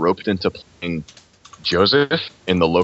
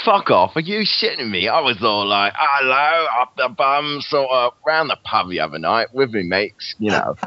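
lively put-on Cockney geezer; broad Cockney, swinging up in pitch; Cockney accent; 4 semitones higher than usual. Friend shows off pub patter, Cockney accent. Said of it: "What's good to say in a Cockney accent?".

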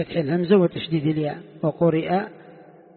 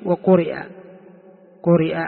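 وقرئ said louder to mark it as a technical term; stressed term; 3 dB louder than usual. Shown as a demonstration. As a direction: highlight وقرئ, then قرئ. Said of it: "يقول إيش؟". (تشديد على كلمة وقرئ)

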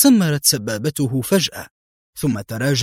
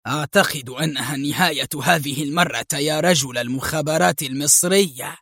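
ordinary delivery

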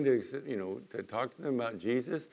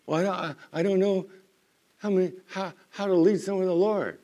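higher, stammering mock believer; nervous stammer; 7 semitones higher, 4 dB louder than usual. Preacher mimicking a nervous churchgoer, higher and stammering. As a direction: act out a flustered believer tripping over words.

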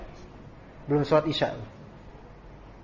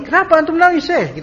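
startled question; 10 semitones higher, 5 dB louder than usual. Retold or imitated. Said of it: "waqala li".

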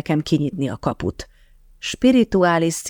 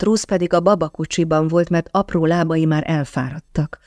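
plain reading voice

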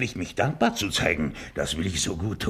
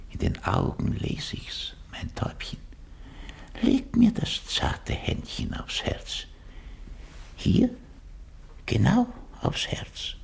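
seductive whisper. Acted. Seducer coaxing in hushed voice.

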